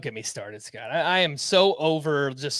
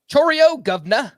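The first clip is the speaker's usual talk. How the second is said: put-on Cockney accent; 9 semitones higher, 7 dB louder than usual. Reads punny name in accent.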